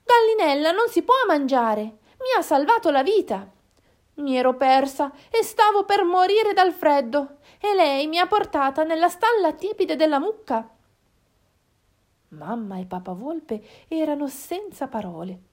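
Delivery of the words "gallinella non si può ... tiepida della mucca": high, insistent pleading; small raised voice, pleading her case; protesting fox cub. Acted. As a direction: argue earnestly for a friend's life. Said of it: "protestò Valpacchiotta".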